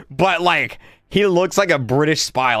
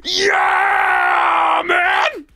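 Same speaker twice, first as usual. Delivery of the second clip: ecstatic whoop; much slower than usual. Retold or imitated. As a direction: whoop it like a guy who just got engaged. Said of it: "He was like".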